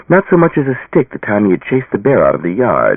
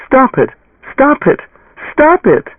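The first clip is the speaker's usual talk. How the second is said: rising ever higher and louder; each cry more desperate; building cries; 4 dB louder, slower than usual. A narrator's reading. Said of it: "she cried".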